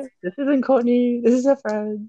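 put-on high, sweet girly voice; girl voice. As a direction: pretend to be the girl on the phone. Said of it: "put on your best girl voice".

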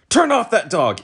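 high, loud command; barked order. A narrator's reading. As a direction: snap the order at the dog. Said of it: "he said".

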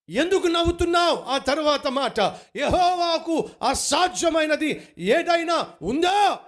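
high, loud, impassioned preaching with the voice lifted; fervent preaching. Real, not acted. Preacher to congregation, asking why they laugh, impassioned and loud. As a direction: press the question on the congregation with rising fervour.

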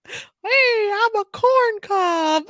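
very high, squeaky cartoon corn; talking vegetable for a toddler; corn cob voice. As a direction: put on a silly high voice, drawn out. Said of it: "Now do the corn's voice".

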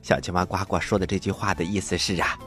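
plain reading voice

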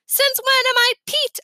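high, loud, rising indignant question. A narrator's reading. Big brother objecting to a new nickname, indignant.

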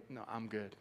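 low, soft, casual dismissal; laid-back, hushed and low; unworried. Retold or imitated. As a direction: wave the worry away quietly. Acted calm reply amid turbulence.

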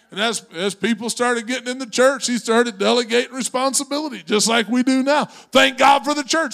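high, loud building sermon; preaching. Acted. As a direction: preach it, rising in force. Preaching builds to full force.

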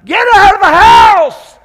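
high, loud, slow yell; screaming mom. Retold or imitated. Mom screaming at kids to flee the fire, loud and high. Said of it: "she's gonna be screaming at him".

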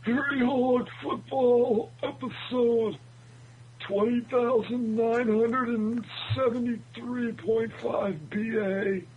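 put-on high, slow creaky old-timer; old man voice. Hosts imagine themselves aged seventy-two.

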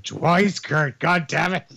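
high, anguished mock yell; hammed-up exasperated wrestler in pain; imagined Shane. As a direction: wail it slowly from the floor. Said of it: "imagine Shane on the floor just like".

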